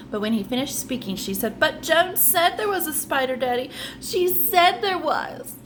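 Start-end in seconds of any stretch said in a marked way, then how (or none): 1.6-5.5 put-on child voice. Child protests to father.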